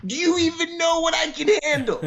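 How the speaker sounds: put-on high, frustrated kid voice; exasperated young son. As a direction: protest like a fed-up teenager. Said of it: "I remember thinking".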